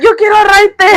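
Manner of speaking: high, eager mom voice; imitated mom. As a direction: imitate mom wanting a ride. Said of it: "She's like".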